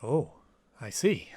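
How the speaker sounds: a breathless little whisper, barely voiced. [name], taken aback by Ella's confession, whispers. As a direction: let it slip out as a hushed whisper. Stunned reaction to Ella's story.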